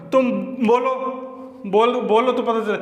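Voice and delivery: ordinary delivery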